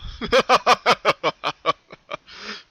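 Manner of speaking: high rising evil cackle; maniacal laugh. Acted. The colt cackling over his victim, evil cackle. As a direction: let the laugh climb into madness. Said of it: "The colt laughed".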